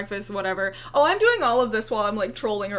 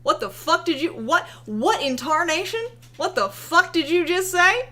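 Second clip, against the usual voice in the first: higher, louder incredulous yell; mock outrage; 6 semitones higher, 4 dB louder than usual. Mimicking conservative followers scolding her, mock outrage. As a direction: play the offended conservative fan. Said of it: "her conservative followers said".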